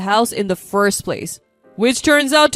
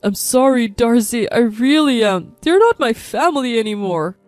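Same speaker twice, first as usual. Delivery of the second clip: louder, pained apology; upset husband; 6 dB louder than usual. A narrator's reading. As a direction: apologise as a husband close to breaking. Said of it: "my usually stoic husband broke down".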